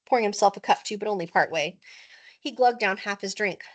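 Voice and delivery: plain reading voice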